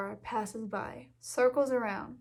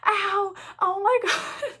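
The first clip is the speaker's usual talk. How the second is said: pained yelp breaking into a laugh; yelling in pain; 8 semitones higher, slower than usual. Retold or imitated. Girl hurting her hands on the bat, yelping then laughing. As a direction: cry out from the sting, then laugh. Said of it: "yelling in pain".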